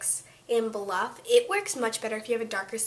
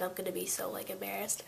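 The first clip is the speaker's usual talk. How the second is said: soft, breathy whisper; whispered aside; 9 dB quieter than usual. Real, not acted. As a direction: drop to a whisper for embarrassed.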